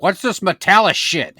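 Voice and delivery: put-on loud, high, scornful old man's complaint; loud grumpy grandpa. Grandpa loudly scoffing at Metallica fans at German Fest. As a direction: bark it out in disgust. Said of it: "He'd say it, like, real loud".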